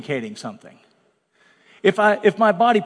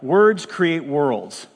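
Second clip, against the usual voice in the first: slow emphatic; 3 semitones lower, much slower than usual. Acted. Sermon key line, slow.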